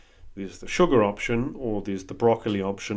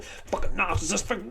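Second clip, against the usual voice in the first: frustrated inner voice; 9 semitones higher than usual. Retold or imitated.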